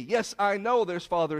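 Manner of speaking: ordinary talk, nothing marked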